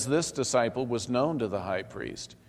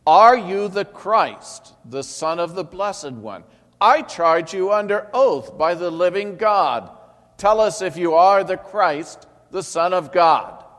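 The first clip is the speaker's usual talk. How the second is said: high priest demanding; 9 semitones higher, 12 dB louder than usual. A narrator's reading. High priest putting Jesus under oath, loud and high. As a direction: thunder the charge at him.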